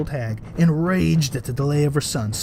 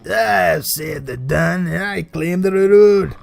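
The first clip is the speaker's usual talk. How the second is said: slow, louder, proud claim; steward's voice; 4 semitones higher, 7 dB louder, slower than usual. A narrator's reading. Steward boasting to the king, slow and proud. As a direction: puff up and claim it slowly.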